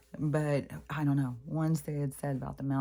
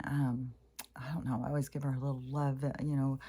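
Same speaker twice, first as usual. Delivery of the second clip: ordinary delivery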